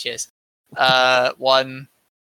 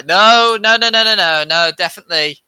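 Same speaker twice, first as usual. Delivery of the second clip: emphatic denial; 4 semitones higher, 5 dB louder than usual. Pretend. Mock franchise denial.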